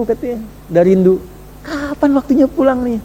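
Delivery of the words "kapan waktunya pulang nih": longing question. Retold or imitated. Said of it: "beliau kalimatnya begini".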